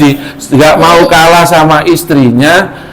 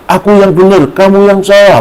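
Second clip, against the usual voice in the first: put-on arguing child; 7 semitones higher than usual. Schoolkids arguing.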